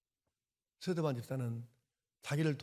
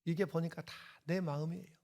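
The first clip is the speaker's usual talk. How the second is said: usual speaking voice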